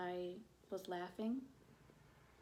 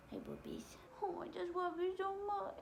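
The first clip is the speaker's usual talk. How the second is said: sweet talk to a little darling; affectionate cooing; 12 semitones higher than usual. Real, not acted. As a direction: coo adoringly, much higher than usual. Breaks off to coo affectionately.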